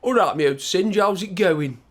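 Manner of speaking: Stoke accent. Shown as a demonstration. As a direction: greet a mate in thick Stoke accent. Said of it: "So with the Stoke accent, it's amazing. So it sounds like this".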